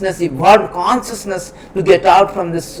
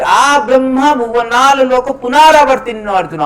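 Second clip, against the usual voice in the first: raised verse recitation; 6 semitones higher than usual. Acted.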